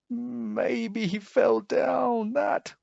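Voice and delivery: high slow fearful wail; wailing cat. A narrator's reading. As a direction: fret aloud at the cliff edge. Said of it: "wailed the cat".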